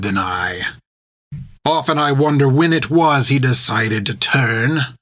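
put-on pirate captain taunting the old nun; menacing pirate. A narrator's reading. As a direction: savour every insult like a cruel captain. Creech voiced in the story.

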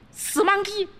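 very high 死monkey shrieked; shrill princess. Acted. Princess insults again.

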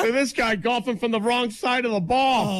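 high mocking golf heckler. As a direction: jeer like a snooty onlooker. Mocking heckler at left-handed golfer.

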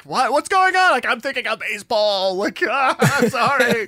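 high, loud panicked blurting; comic flustered outburst, pitched well up; mock panic. Host acting out a flustered lover apologizing, mock panic.

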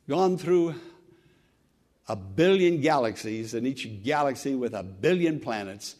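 ordinary talk

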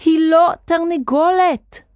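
high, slow emphatic shout; loud, drawn-out insistence. A narrator's reading. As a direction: cry it out in upset protest. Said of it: "צעק נוח".

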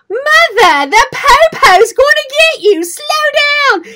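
high, loud mock British accent; son's British accent. Son teasing mom from the passenger seat in a loud, high British accent. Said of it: "accent things in a British accent".

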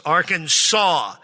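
Arkansas as three syllables, ending in "saw"; official state pronunciation. Shown as a demonstration. Preacher reading the state code aloud, Arkansas said "AR-kan-saw". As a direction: say Arkansas slowly with the final S silent. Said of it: "only acceptable pronunciation is in three syllables".

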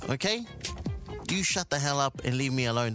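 ordinary talk

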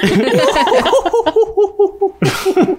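high witch cackle; old hag cackle. Pretend. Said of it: "A risada de bruxa é a minha". (gargalhada aguda de bruxa)

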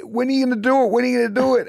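put-on high, pleading little boy; anxious child voice. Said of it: "he's going".

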